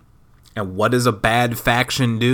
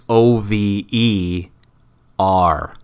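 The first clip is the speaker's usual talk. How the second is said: spelled out slowly; much slower than usual. Shown as a demonstration. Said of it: "You see, it was O V E".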